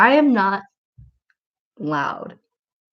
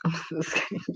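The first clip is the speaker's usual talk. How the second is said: laughing whisper; 12 dB quieter, much faster than usual. Real, not acted. Teasing a viewer's question.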